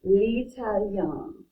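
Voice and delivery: L'Italien stretched out slowly, syllable by syllable; teacher sounding out L'Italien at half speed; slow demo. Shown as a demonstration. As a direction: model L'Italien slowly for the class. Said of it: "And then we have l'italien".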